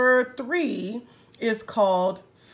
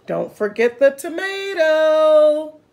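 cheerful rabbit; 4 semitones higher, 8 dB louder than usual. Acted. Rabbit adds tomato.

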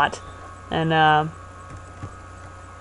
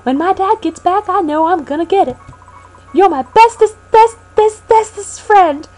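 Pokey voice; 9 semitones higher than usual. Acted. Voicing Pokey character.

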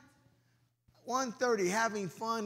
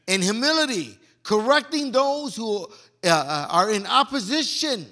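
loud fervent preaching; 3 semitones higher, 8 dB louder than usual. Acted. Pastor closing the sermon to the congregation, loud and fervent. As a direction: drive the verse home loudly to the church.

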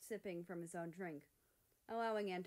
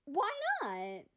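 much higher, louder; dramatic outrage; 10 semitones higher, 10 dB louder than usual. Acted. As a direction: demand it with drama. Mexico continues outburst.

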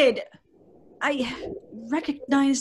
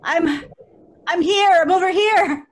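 raised voice calling across the dark; 5 semitones higher, 6 dB louder than usual. Acted. Wife calling to her husband in the graveyard, raised voice. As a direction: call out to find him.

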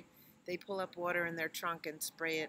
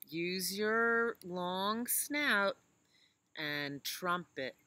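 slow; 5 dB louder, much slower than usual. Acted. Slow drawn instruction.

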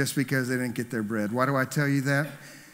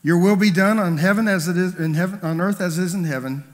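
ringing, louder prayer recitation; 3 semitones higher, 5 dB louder than usual. Acted. Preacher opening the sermon, reciting the prayer aloud. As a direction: lift the Lord's Prayer out to the room.

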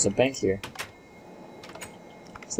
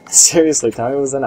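laughing; 4 semitones higher, 7 dB louder, faster than usual. Real, not acted. Laughing demand after joke answer.

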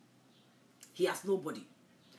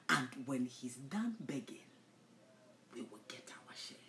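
hushed, scheming whisper; conspiratorial whisper; 6 semitones lower, 7 dB quieter than usual. Retold or imitated. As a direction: murmur it like a secret plot. Voicing the schemers' plan.

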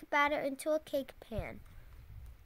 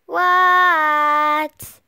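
loud, drawn-out "What?"; big loud answer from afar; shouting back; 15 dB louder than usual. A narrator's reading. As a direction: holler back at a calling parent. Froggy answers his father's call.

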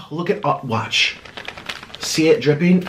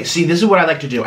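usual speaking voice

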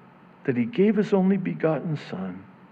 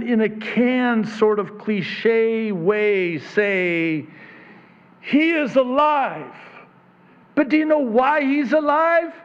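higher, louder, slow and insistent preaching; full-force preaching; 12 semitones higher, 6 dB louder, slower than usual. Acted. Preacher proclaims to the congregation that Jesus is alive, full-force preaching. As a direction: drive each phrase home from the pulpit.

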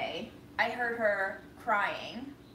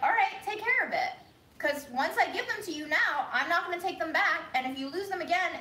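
exasperated; 7 semitones higher than usual. Retold or imitated. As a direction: hand them over with exasperation. Narrator exasperated with grandma.

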